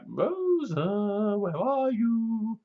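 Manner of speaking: high, soft, drawn-out searching call; singsong calling. Retold or imitated. Calling for the missing Rose, singsong.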